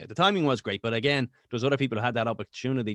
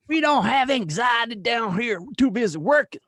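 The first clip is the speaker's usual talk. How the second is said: put-on loud, high Southern good-old-boy accent; 9 semitones higher, 7 dB louder than usual. Comic boasting to his co-hosts about life down here, exaggerated Southern accent. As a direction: holler it in a hammed-up Southern accent. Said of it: "when you do a Southern Max accent".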